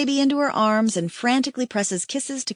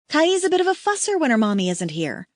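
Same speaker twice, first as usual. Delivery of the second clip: ordinary delivery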